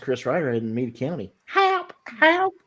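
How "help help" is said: high-pitched mock call for help; screechy cry. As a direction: squeak it out like a damsel in distress. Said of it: "Jesus, Screecher".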